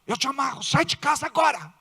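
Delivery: casting out the demon, rising in force; rebuking prayer. Shown as a demonstration. Wife rebuking the demon in her husband, building command. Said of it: "ora baixinho e diz".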